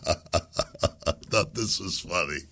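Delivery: usual speaking voice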